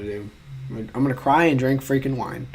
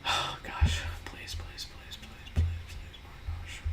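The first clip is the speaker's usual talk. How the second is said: hushed, breathy begging under the breath; whispered pleading; 13 dB quieter, slower than usual. Real, not acted. Begging quiz result to come out right, whispered plea. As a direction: whisper a string of desperate pleases.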